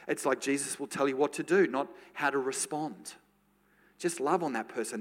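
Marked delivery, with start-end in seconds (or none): none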